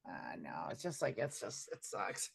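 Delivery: soft, low whisper. Real, not acted. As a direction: whisper it under your breath. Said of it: "sudden whisper".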